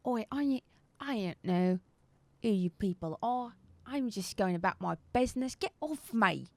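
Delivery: lightly voiced cockney pleading; cockney denial, a bit put-upon; cockney satyr. Pinned satyr denying everything in light cockney. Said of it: "still with this accent".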